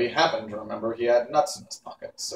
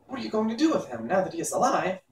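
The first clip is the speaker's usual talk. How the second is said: higher, quick and curious; a boy wondering aloud; young boy Tip; 8 semitones higher, faster than usual. Acted. Boy Tip asking the witch about the Pumpkinhead, high and quick.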